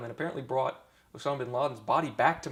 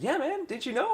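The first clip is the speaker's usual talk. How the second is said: a lightly voiced buddy, much higher; imitating his buddy; 5 dB louder than usual. Buddy telling him bin Laden was killed, lightly voiced and higher. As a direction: play the casual friend breaking the news.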